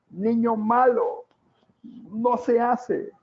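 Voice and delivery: high, slow mock scolding of a small child; scolding adult. Adult scolding the scribbling child, high and slow. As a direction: wag a finger at a naughty toddler. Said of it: "y le dice".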